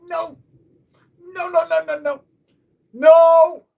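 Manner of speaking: high, rising to a loud mock scream of no; hammed-up terror, building to a yell; mock panic. Refusing to face Claudia, hammed-up panic building to a loud scream. Chris pushing wife onto him.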